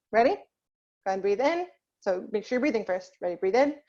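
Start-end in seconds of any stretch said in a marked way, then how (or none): none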